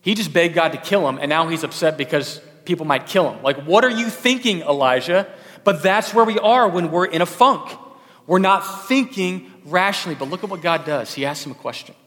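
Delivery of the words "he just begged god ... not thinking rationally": loud, higher, pressing the point; preaching at full force. Real, not acted. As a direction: drive it home to the congregation.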